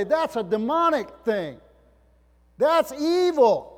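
high, loud cry building to a drawn-out peak; rising, emphatic accusation; loud denunciation. Acted. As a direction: denounce it at full preaching force. Acts out disciples denouncing apparition.

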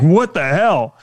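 a tourist jolted by a bang, yelling; shocked outburst. Retold or imitated. Tourist at a cable car window, startled yell. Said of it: "You'd be like".

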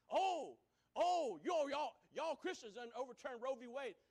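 loud, hammed-up protest; mock outraged accusation. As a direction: imitate an indignant crowd pointing blame. Acting out outraged accusers of Christians.

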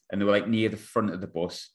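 ordinary talk, nothing marked

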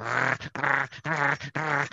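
repeated mock snarling dog noise; imitated angry Chihuahua noise. Describing an angry Chihuahua, imitated constant angry dog noise. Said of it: "like, constantly, like ... noise".

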